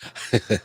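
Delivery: soft, short breathy chuckle; low-key amused laugh. Real, not acted. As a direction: chuckle quietly to yourself. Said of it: "I'm laughing because".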